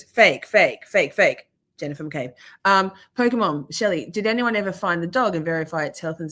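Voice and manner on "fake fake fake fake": mock Elaine. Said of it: "And she goes".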